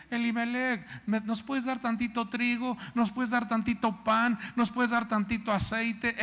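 mock-sweet beggar asking for favors; pleading neighbors. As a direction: play the needy neighbor knocking at the door, asking sweetly. Neighbors begging Elimelec for food.

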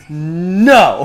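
loud, emphatic mock "no"; big booming retold no; imitated refusal. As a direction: act out a friend's harsh refusal. Acting out a blunt refusal.

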